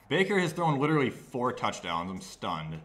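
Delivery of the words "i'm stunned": low, soft deadpan; flat deadpan. Real, not acted. Gamer numbed by the opponent's touchdowns, deadpan. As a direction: say it dead flat.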